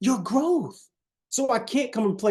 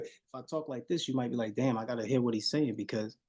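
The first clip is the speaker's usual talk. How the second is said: much softer and lower, almost confiding; hushed talk; 6 semitones lower, 9 dB quieter than usual. Shown as a demonstration. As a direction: drop to a near-hush so they strain to hear.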